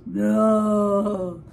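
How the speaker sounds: low mock bellow; a lightly voiced hippo bellowing no; playful low hippo no. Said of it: "he bellows".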